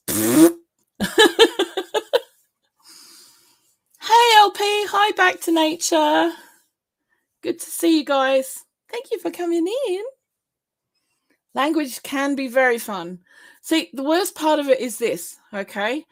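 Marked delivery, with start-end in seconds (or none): 0.1-10.0 high, bright mock shop greeting; chirpy greeting voice. Brain greeting customers, cheery high voice. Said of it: "my whole little brain just goes".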